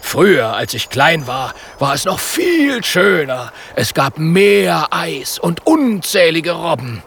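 lightly voiced bear longing for the past; polar bear voice. Polar bear telling friends about his childhood, wistful.

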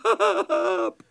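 slow, weepy and breaking; sobbing. Acted. The goblin tells Eder he forgot his egg, sobbing. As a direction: cry out the words, half sobbing. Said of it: "Pumuckl weeps over the lost egg".

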